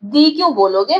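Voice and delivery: usual speaking voice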